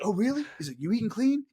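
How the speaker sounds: playing the character, high; mock character voice. Imitating the character.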